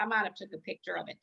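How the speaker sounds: usual speaking voice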